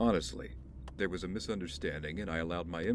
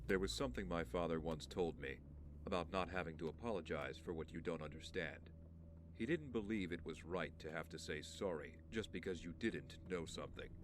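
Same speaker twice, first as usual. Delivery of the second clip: soft, measured recollection; quiet confiding; 10 dB quieter than usual. Acted. Man shares what his father once told him, quiet confiding. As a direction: recall it gently, much softer.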